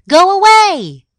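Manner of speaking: high, very loud shooing cry; shouted order. A narrator's reading. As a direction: drive the fox off. Goose chases off the fox.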